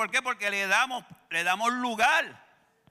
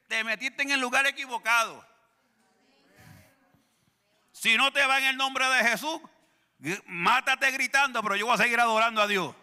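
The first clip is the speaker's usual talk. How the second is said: defiant rebuke; 5 semitones higher, 5 dB louder than usual. Retold or imitated. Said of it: "le tengo que decir".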